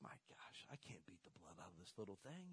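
put-on hushed whisper over a sleeping child. Dad carrying his sleeping daughter in, whispering. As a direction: whisper so she won't wake.

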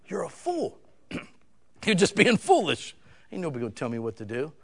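ordinary talk

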